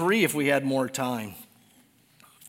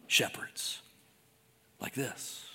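whispered aside; 3 semitones lower, 10 dB quieter, slower than usual. Real, not acted. Trailing dramatic drop.

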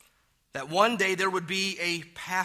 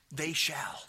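whispered they shall. Shown as a demonstration. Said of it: "You hear?".

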